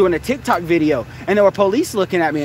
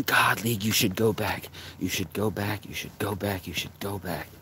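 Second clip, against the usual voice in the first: repeated, getting softer each time until it fades; fading mutter; 8 semitones lower, 11 dB quieter than usual. Real, not acted. Muttering to himself about going back, fading out. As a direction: let each repeat drop away into a mutter.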